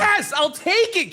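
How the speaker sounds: very high, drawn-out cheer; gleeful acceptance. Real, not acted. Host gets a good score for the accent, high gleeful cheer. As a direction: squeal it in triumph.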